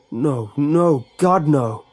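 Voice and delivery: loud despairing cry at chained doors; mild scream of despair, yanking the doors. Acted. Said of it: "Jeremy screamed".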